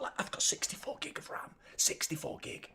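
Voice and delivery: whispered disbelief; exasperated whisper. Real, not acted. Streamer complaining about ram error, whispered. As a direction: hiss it in frustration.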